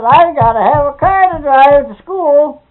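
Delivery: high, loud, slow mock demand; retold demanding daughter. Girl demanding a car from her father, imitated insistence.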